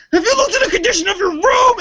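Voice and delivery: high-pitched mock-angry dad tirade; dad laying into son. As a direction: yell it like an exasperated dad, way up high. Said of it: "starts to lay into me".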